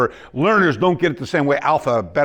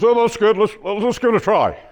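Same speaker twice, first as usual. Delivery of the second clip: high, louder eager crowd voice; excited crowd; 9 semitones higher, 4 dB louder than usual. Retold or imitated. Seminar students keen to try the move, high and eager. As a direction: act out eager students jumping in.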